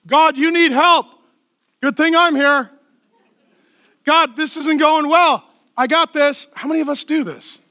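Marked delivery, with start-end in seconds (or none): 0.1-6.5 louder, higher, slow and self-assured; cocky Peter. Retold or imitated. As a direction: play Peter bossing God around, overconfident. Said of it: "here's the heart of Peter".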